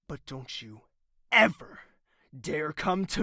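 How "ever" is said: "ever" punched high and loud; "ever" as the loud peak of a threat; stress on ever. Acted. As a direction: hit "ever" hard. Don't you ever dare.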